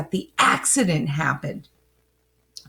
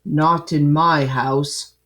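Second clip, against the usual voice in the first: put-on gruff old man; 5 semitones lower, 3 dB louder than usual. Gruff Mister Whiskers retort.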